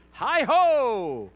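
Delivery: loud, high, ringing call; mock riding cry. Pony guide telling what they say, hollered playfully. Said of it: "She said, "No, they say".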